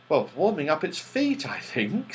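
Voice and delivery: plain reading voice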